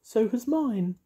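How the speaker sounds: high, sing-song witchy voice; bright, bossy little witch. Acted. Mavis voiced.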